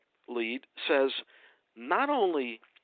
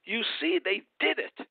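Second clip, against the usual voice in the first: high mocking imitation of the president, gloating; mocking Trump; 9 semitones higher than usual. Mocking the president's gloating claim to the talk show panel. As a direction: sneer out his victory claim in a raised voice. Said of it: "goes today and says".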